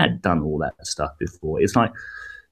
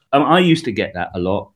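ordinary talk

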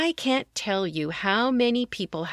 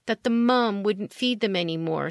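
usual speaking voice

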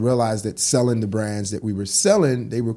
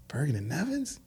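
higher, softer doubtful echo; skeptical questioning; 6 semitones higher, 7 dB quieter than usual. Retold or imitated. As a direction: imitate a friend doubting the name. Buddy doubting the store name.

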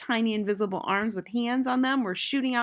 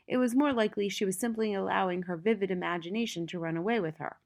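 plain reading voice

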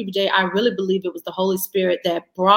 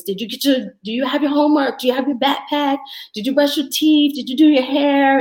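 mock yelling at kids; 5 semitones higher, faster than usual. Mother calling to the kids on a rushed morning, raised voice. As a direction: hurry the kids, half-yelling. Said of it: "I start yelling out to the kids".

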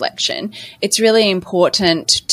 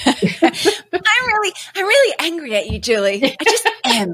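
laughing through a high-pitched mock complaint; giggly, higher-pitched fake outrage; mock anger; 10 semitones higher than usual. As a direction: pretend to be angry but crack up.

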